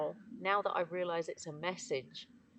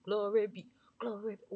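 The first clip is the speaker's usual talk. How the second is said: louder, churchy glory be; preaching praise; 3 semitones higher, 6 dB louder than usual. Real, not acted. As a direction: testify like at a revival.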